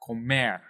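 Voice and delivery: "Comer" with "mer" longer and stronger; teacher's demo of "Comer", stress at the end; stressed last syllable. Shown as a demonstration. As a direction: punch the final "mer" of "Comer". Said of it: "say that a little bit louder".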